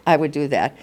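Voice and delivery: normal talking voice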